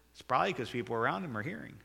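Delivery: ordinary delivery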